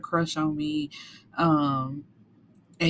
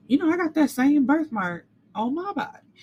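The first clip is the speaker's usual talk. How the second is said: higher, mock dawning realization; retold shock at the birthmark, higher and louder; realizing surprise; 9 semitones higher, 4 dB louder than usual. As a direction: pitch up as it clicks for him.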